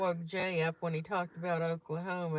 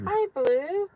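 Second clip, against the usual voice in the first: excited greeting; 12 semitones higher, 9 dB louder than usual. Real, not acted. Greeting someone on live chat.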